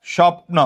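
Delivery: স্বপ্ন as "shop-no", p and n together; no vowel between p and n in স্বপ্ন; cluster in স্বপ্ন. Shown as a demonstration. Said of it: "আমরা যদি এরকম বলি". ("স্বপ্ন"-এ প আর ন যুক্ত উচ্চারণ)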